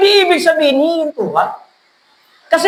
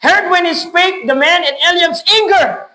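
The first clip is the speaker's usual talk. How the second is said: scripture reading; 2 semitones higher, slower than usual. Acted. Preacher reading scripture aloud.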